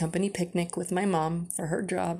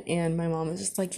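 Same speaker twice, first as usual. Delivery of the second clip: ordinary talk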